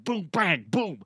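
exaggerated sportscaster impression; John Madden. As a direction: bluster through the play-by-play. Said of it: "how to do John Madden".

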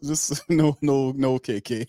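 usual speaking voice

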